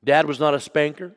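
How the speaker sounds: usual speaking voice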